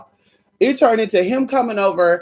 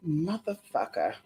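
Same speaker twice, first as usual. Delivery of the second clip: under-breath curse; 11 dB quieter, slower than usual. Real, not acted. Muttered in frustration.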